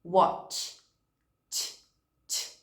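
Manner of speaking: "Watch" and "t" said with no voice; teacher models the unvoiced end of "Watch"; voiceless ending. Shown as a demonstration. Teacher shows the final sound of watch. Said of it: "Não vibra".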